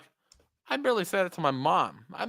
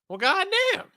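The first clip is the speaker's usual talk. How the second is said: high-pitched put-on voice of a dumbfounded friend; mock stunned friend; 3 dB louder, slower than usual. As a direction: squeak it out, thrown by the hang-up. Imitating friend after hang-up.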